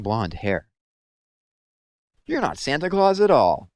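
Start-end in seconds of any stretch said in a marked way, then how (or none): 2.2-3.7 high, loud cross complaint; crossly. A narrator's reading. Owlet telling the young lady off, cross. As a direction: sulk out loud, let down. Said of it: "he said crossly".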